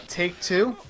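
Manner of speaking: usual speaking voice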